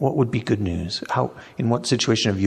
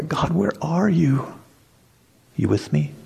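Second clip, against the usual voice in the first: searching plea; 6 semitones higher than usual. Acted. Believer in hardship checking God is there, searching plea. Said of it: "other times it might be more like".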